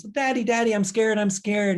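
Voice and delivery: put-on high, pleading little child; scared toddler. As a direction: call out to daddy, frightened. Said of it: "the baby's".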